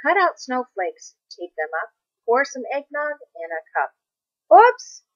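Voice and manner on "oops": sudden high, loud yelp of surprise; surprised oops. A narrator's reading. Narrator reading to a child, a startled oops. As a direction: blurt it like a spill just happened. Eggnog spilled on the floor.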